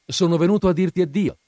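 loud, high and fast goodbye; exclaimed farewell. A narrator's reading. Swallow to the prince at moonrise, exclaiming. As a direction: burst out with the news of leaving. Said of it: "esclamò".

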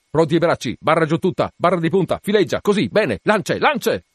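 high, brisk commands in a string; shouted orders. A narrator's reading. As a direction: call out each manoeuvre to the crew.

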